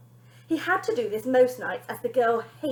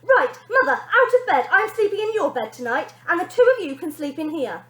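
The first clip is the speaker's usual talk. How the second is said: higher, louder commanding brat; bossy girl; 5 semitones higher, 8 dB louder than usual. Acted. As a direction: throw the parents out of bed. Bonnie evicts parents from bed.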